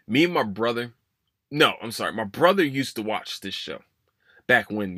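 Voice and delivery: usual speaking voice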